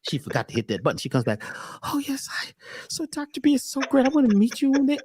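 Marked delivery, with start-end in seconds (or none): 1.8-5.0 high, put-on gushing; fake sweet voice. The lady comes back to Doctor B in a high, fake-sweet gush. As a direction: lay the fake charm on thick. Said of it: "They get a voice".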